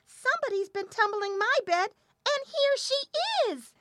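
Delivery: very high squeak, slowing at the end; piping little bear. A narrator's reading. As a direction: pipe it high as the little bear, drawing out the last words. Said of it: "the little bear piped".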